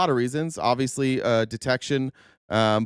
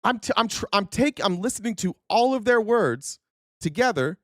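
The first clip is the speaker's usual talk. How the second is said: ordinary talk, nothing marked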